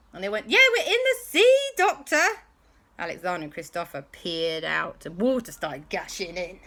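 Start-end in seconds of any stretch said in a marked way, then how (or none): 0.5-2.4 high, loud rising retort; exasperated shout. Acted. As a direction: tell the Doctor the obvious. Everyone exasperated at Doctor.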